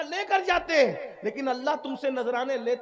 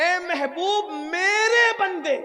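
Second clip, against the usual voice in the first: tender; 4 semitones higher, much slower than usual. Acted. Tender voicing of God's words.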